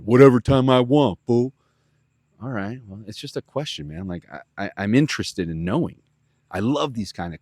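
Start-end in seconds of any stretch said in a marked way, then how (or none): 0.0-1.5 loud, gruff brush-off; imitating Nacho, much louder; tough guy retort. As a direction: snap back like a tough guy.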